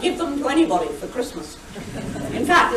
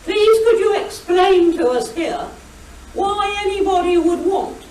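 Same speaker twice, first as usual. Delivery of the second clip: high-pitched, earnest questioner; imitating a deadpan lady's public question; straight-faced question; 7 semitones higher than usual. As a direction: ask it in all seriousness, pitched up. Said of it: "with a completely straight face".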